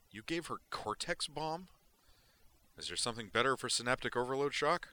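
put-on high, startled young guy; Zoner's voice. Zoner asks Duke about the unconscious woman, high and puzzled. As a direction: toss the question off high and quick.

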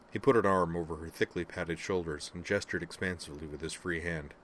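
usual speaking voice

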